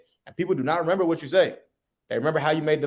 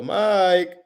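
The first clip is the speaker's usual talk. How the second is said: Mike said higher and louder, playful; upbeat call; 5 semitones higher, 5 dB louder than usual. Shown as a demonstration. Friend greeting Mike, playful upbeat call. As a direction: sing his name out cheerfully. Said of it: "But if I go".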